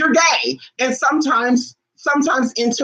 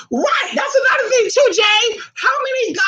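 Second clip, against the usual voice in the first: high excited shout. Real, not acted.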